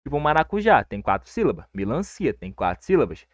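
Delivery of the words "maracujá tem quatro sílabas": normal talking voice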